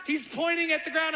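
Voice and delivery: ordinary talk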